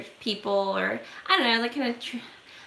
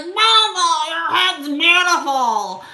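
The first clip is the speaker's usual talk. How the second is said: higher, louder, slow mock leer; creepy compliment; 7 semitones higher, 6 dB louder, slower than usual. Stranger calls after her about her shaved head, hammed-up leer. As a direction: ham up the stranger crooning at her. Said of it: "And he's like".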